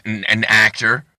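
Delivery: normal talking voice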